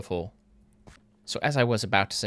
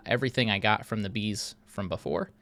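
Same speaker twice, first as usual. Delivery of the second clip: ordinary delivery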